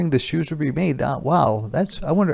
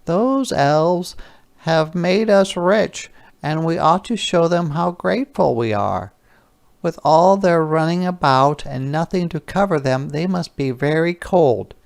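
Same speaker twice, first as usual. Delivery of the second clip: higher, gentle wife's voice; shoemaker's wife; 6 semitones higher than usual. Acted. Wife telling her husband they should thank the elves, higher kindly voice. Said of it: "the shoemaker's wife said to her husband".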